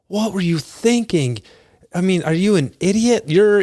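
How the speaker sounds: high-pitched imitation of an exasperated parent; scolding parent. Parents catching their kids smoking, high-pitched mock scolding.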